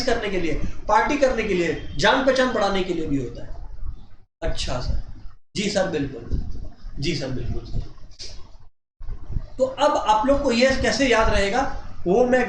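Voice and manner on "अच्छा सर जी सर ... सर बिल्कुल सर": low, soft obedient student; meek student saying yes sir; student reply. Acted. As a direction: mumble agreement like a meek pupil. Teacher voicing student replies.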